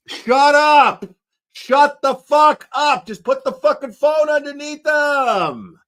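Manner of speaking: angry yell. Acted. Drunk conspirator screams at his partner to shut up, angry shout. Acted conspirator yelling at crew.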